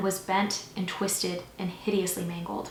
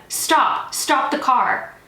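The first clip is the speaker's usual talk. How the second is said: loud, higher shouted warning; urgent shout; 5 semitones higher, 7 dB louder, much slower than usual. A narrator's reading. Officer to a driver sliding towards him, urgent shout. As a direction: yell to halt an oncoming car.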